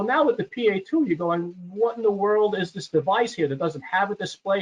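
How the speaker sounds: normal talking voice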